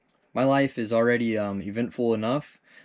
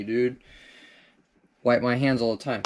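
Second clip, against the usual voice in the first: ordinary talk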